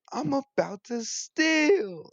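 high, drawn-out singsong impression; loud, slow mimicked catchphrase; quoted viral video guy. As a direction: stretch it out high and silly, like the meme. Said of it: "He's like".

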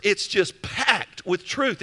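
usual speaking voice

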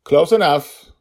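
high, loud yell across the distance. A narrator's reading. Little dragon girl yells back to her hiding father, loud high call. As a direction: call it out to the forest. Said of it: "she yelled back".

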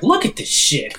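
high, giddy amazement retold; delighted actor. Actor marvelling at his own scene on commentary, delighted. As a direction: imitate an actor thrilled with the effect. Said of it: "he was happy about it".

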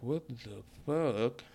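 slow, drawn-out what the fuck; stunned disbelief. Retold or imitated. Reacting to her comment, slow and incredulous. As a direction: let the disbelief stretch it out.

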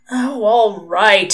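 loud, drawn-out grudging surrender; slow, exasperated giving-in; wretched concession. A narrator's reading. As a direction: give in miserably, dragging the words out. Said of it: "said Crowley wretchedly".

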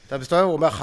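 ordinary delivery

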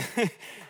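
breathless laugh. Real, not acted. Laughing at the old lady's words.

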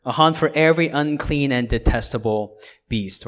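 loud, emphatic reading of scripture; preacher reading Revelation aloud. Acted. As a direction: proclaim the verses with weight.

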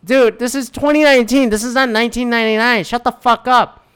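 high-pitched, indignant telling-off; exasperated retort. Retold or imitated. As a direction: scold a heckler in disbelief. Retold retort to heckler.

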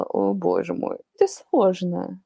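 ordinary talk, nothing marked

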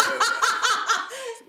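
sudden high burst of laughter; high, loud cackling laugh. Real, not acted. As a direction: crack up loudly mid-story. Bursts out laughing at own story.

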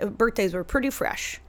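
normal talking voice

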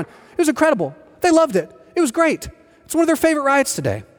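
high, beaming recollection of the ride; delighted. Real, not acted. Pastor to church, gushing about the kids' favorite ride. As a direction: gush about how much they loved it.

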